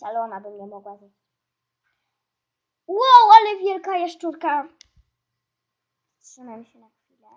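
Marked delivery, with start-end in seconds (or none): 2.9-4.7 loud, high cry of amazement; amazed exclamation. Real, not acted. Gamer sees a giant lizard, amazed exclamation. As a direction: burst out in big surprise.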